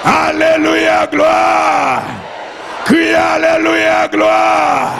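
high, sustained chanted shout; praise cry. Real, not acted. Preacher leading the cry of the saved, chanted shout. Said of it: "c'est le cri des sauvés".

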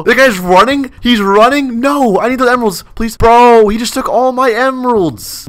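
high-pitched disbelief; incredulous, pleading high voice; indignant protest. Real, not acted. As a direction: whine in outrage at being robbed. Robbed of emeralds, protests.

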